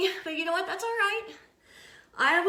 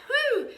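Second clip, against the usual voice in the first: high, falling "whew"; exertion whew; 10 semitones higher, 4 dB louder than usual. Real, not acted. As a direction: blow off the effort with a high whew. Finishing a hard push-up set.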